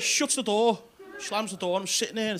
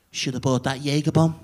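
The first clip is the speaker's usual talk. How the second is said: mock menacing whisper; 5 semitones lower, 4 dB louder than usual. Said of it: "whispers in me".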